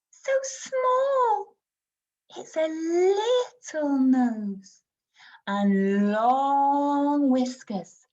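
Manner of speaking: put-on slow, high, wide-swooping voice for a little child; storytelling, exaggerated emphasis. Shown as a demonstration. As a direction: stretch the describing words like a picture-book reader. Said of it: "being a bit more storytellery".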